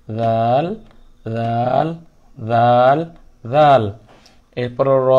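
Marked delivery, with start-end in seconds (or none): 0.1-3.9 দাল said soft, tongue light; soft দাল drill; soft dal. Shown as a demonstration. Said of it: "দালও সেরকম নরম".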